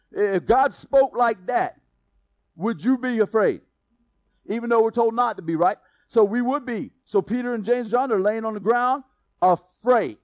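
loud, ringing preacher's proclamation; fiery sermon delivery; preaching at full force. Acted. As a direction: build the crowd up and proclaim it.

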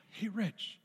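high, plaintive-style imitation; slow it down and pitch it up, helplessly lost; lost caller. Invited guy phones the speaker, high lost-caller voice.

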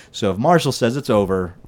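ordinary talk

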